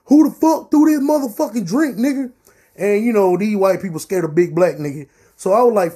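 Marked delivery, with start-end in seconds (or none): 0.1-2.3 loud, high yell at the crowd below; angry shout. Retold or imitated. Soaked by a drink, he yells down at the crowd, angry. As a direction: bellow the question downstairs.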